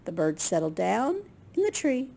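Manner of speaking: plain reading voice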